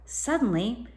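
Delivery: usual speaking voice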